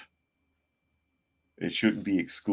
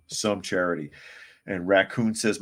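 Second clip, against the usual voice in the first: normal talking voice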